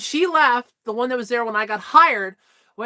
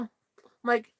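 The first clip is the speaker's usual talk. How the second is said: normal talking voice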